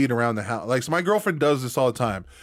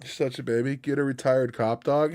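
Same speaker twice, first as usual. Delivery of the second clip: usual speaking voice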